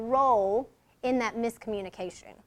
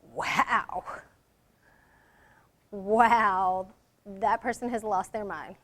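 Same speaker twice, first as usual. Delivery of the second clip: disapproving wow; 4 semitones lower, slower than usual. Shown as a demonstration.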